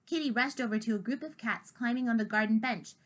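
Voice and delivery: ordinary delivery, nothing marked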